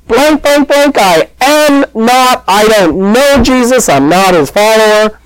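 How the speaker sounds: high-pitched, insistent protest; emphatic bleeped-out outburst, easing as it goes; mock cursing denial. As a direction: act out a swearing denial, pitch way up. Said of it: "he curses and says".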